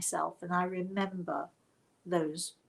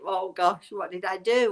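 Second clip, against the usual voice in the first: mock panic; 7 dB louder than usual. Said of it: "is it".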